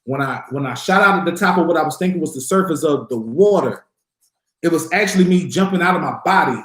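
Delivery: ordinary talk, nothing marked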